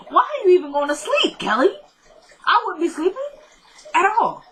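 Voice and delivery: real exasperation at Kelly for sleeping; excited disbelief. Real, not acted. Urging Kelly on the show.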